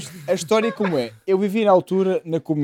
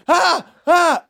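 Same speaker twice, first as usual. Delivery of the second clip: dois gritos curtos e agudos; grito demonstrado; 9 semitones higher, 5 dB louder than usual. Shown as a demonstration. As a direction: imitar alguém a gritar, agudo e mais alto. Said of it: "se tá um gajo a gritar". (imitação de gritos)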